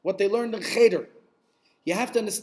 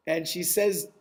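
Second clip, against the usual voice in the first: ordinary delivery, nothing marked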